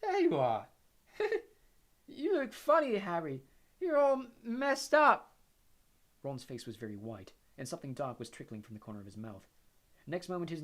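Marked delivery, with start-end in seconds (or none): 0.0-5.4 high, dazed giggly voice; giggling weakly. A narrator's reading. Ron, hit by a curse, giggles weakly at Harry. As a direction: giggle through it, loopy. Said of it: "said Ron, giggling weakly".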